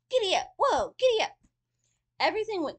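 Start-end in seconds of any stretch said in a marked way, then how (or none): 0.1-1.4 loud, high calls to the horse; shouted commands. A narrator's reading. Tiny boy shouting commands to the horse from its ear. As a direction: yell it out to steer the horse. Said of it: "the little fellow shouted commands".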